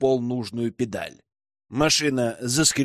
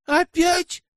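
much higher, louder outraged yelp; flaring up; 3 dB louder than usual. A narrator's reading. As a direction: shoot up in indignation. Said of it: "взвилась блондинка".

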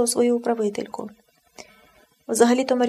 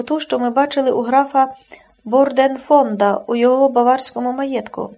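usual speaking voice

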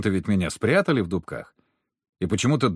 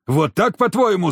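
raised, louder angry retort; indignant challenge; 7 semitones higher, 5 dB louder than usual. A narrator's reading. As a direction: throw it back at him, outraged. Furious hunter challenges the narrator. (возмущённо, на повышенном тоне)

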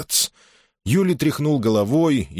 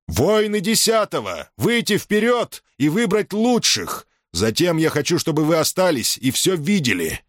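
shouted command; 9 semitones higher than usual. A narrator's reading. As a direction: bark the order across the ranks. Commander shouts orders to ranks. (громкий приказ перед строем)

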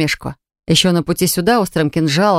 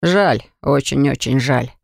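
mock regret; 5 semitones lower than usual. A narrator's reading. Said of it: "хмыкнул колдун".

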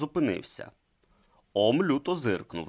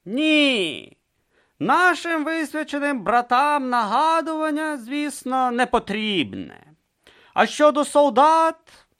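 condescending reply; 5 dB louder than usual. Acted. Vorbis replies.

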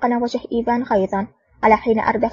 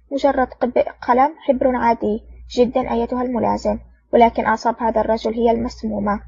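plain reading voice

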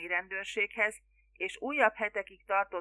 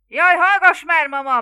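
very loud, high shout; exasperated bellow; 8 semitones higher, 16 dB louder than usual. A narrator's reading. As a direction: roar at your mother to hush. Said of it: "bömbölte Larry". (ingerült, magas bömbölés)

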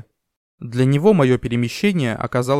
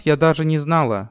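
ordinary delivery, nothing marked

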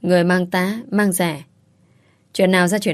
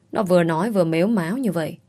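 ordinary delivery, nothing marked

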